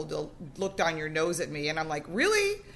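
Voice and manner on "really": high, sharp disbelieving really; mock-shocked really; incredulous really. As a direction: shoot it up in disbelief. Incredulous reaction to condescending dealer.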